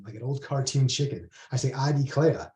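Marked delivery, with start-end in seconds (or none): none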